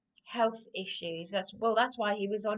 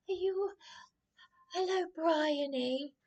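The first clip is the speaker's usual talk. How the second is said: mock greeting; 7 semitones higher than usual. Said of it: "came down and said".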